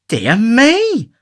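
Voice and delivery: high, sharp little gasp of a cry; prim lady startled; surprised exclamation. Acted. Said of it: "exclaimed Missus Corney".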